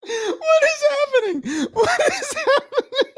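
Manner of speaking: very high, slow wail through laughter; hysterical laugh-crying. Real, not acted. Streamer baffled by tech collapse, laugh-crying. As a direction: squeal it out, nearly crying with laughter.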